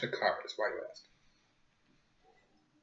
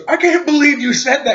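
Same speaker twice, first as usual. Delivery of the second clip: high, loud scandalized exclamation; retold outrage; 14 semitones higher, 14 dB louder than usual. Teacher reacting to the crude answer, scandalized exclamation. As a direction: mimic her shock loudly, pitched way up. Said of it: "And she goes".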